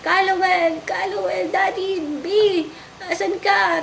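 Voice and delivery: high mock yell for her husband; frantic calling. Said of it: "sisisigaw po ako".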